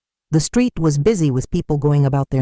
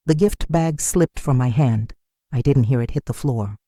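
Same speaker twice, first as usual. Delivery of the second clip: usual speaking voice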